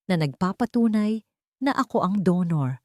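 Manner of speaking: usual speaking voice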